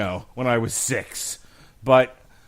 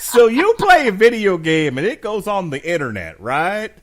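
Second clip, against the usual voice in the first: put-on Southern accent; 6 semitones higher, 9 dB louder than usual. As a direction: toss it off in a joking Southern American accent. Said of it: "I have to do that in a Southern voice".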